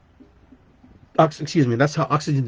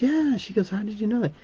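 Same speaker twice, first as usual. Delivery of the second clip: high, soft surprised cleaning lady; imitating the cleaning lady; 10 semitones higher, 4 dB quieter than usual. Cleaning lady amazed he knows [name], high and soft. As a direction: pitch it way up and soften as her.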